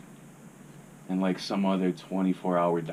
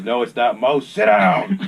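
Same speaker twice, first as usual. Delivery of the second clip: friend scolding; 5 semitones higher, 12 dB louder, slower than usual. Retold or imitated. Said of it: "my friend Caesar goes".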